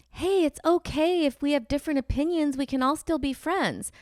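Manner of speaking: lightly sarcastic imitation of a friendly post; mocking sweet tone. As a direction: parody their syrupy niceness. Mocking Trump-voting friends' posts.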